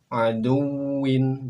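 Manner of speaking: "Aduwin" very slow and loud; model Arabic reading. Shown as a demonstration. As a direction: stretch out "Aduwin". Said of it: "Berikutnya silakan". ("Aduwin" dibaca sangat pelan)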